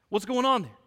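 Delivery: usual speaking voice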